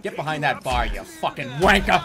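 loud, pumped-up taunting; gleeful brawl shouting; excited trash talk. Real, not acted. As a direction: hype yourself up and jeer at the thugs. Trash-talking enemies in bar fight.

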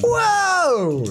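high, startled cry; soaring gamer yelp; excited exclamation. Real, not acted. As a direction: yell it high in amazement. Reacts to the ball challenge.

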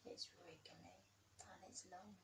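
fast, breathy hushed whisper; suspense whisper. A narrator's reading. Reader building suspense for the child, whispered.

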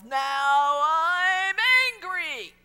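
huge drawn-out high roar, full volume; angry alligator yell. Acted. Arcade alligator yells at the player, drawn-out angry roar. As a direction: bellow it slowly, like an enraged game alligator. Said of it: "turns on this red light and yells".

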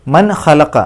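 loud മൻ ഖലഖ with no hum on the n; clear n. Shown as a demonstration. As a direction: sound the n of മൻ openly before ഖലഖ. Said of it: "വെളിവാക്കുക". (മൻ ഖലഖ: നൂൻ വെളിവാക്കുക)

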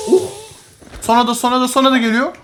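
usual speaking voice

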